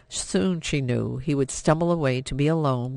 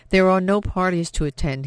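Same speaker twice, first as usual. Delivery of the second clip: bitter jab; 5 semitones higher, 5 dB louder than usual. Acted. Bitter father scolds daughter.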